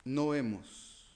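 ordinary talk

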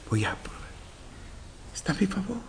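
hushed, secretive self-talk; furtive whisper. Acted. Preacher plays account holder trying the money, furtive whisper. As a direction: whisper it as if sneaking a test.

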